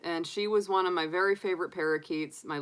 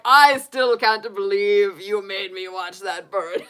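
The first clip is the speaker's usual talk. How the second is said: slow put-on Russian accent, laughing through it; laughing mock Russian accent, drawn out; 3 semitones higher, 9 dB louder, slower than usual. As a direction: imitate the Russian bird man, amused. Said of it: "he had the accent".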